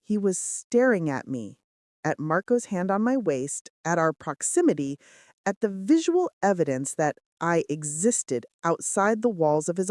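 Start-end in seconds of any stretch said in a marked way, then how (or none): none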